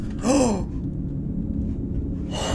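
long, voiceless startled gasp. Real, not acted. Player shocked by the cat, long gasp. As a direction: suck in air in shock.

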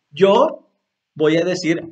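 ordinary talk, nothing marked

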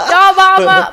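loud, high laugh running into the words; laughing hard at the joke. Real, not acted. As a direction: crack up mid-sentence. Co-caster laughing at blunder joke.

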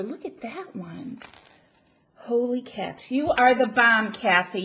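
normal talking voice

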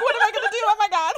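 high, loud laughing fluster; giddy panic. Retold or imitated. Retelling being called by her bias, giddy panic. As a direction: let it burst out high through laughter. Retold fan panic at lucky call.

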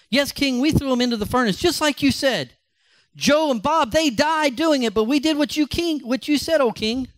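high, eager servant voice pleading with the king; nervous servant. Acted. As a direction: play a flustered servant, pitched high.

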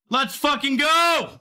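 overjoyed shout at a comeback win; ecstatic cheer. Real, not acted. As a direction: scream it like you just hit the jackpot. Big casino win on stream.

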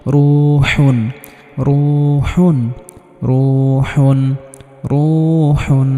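রুহুন with the রু drawn out one alif; long vowel in রু. Shown as a demonstration. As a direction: hold the "u" of রুহুন long, then close on হুন. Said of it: "এক আলিফ পরিমাণ টান হয়েছে".

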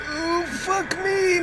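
half-laughing whine of despair; mock crying. Real, not acted. Overwhelmed by the mermaids, mock whimper.

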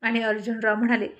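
ordinary delivery, nothing marked

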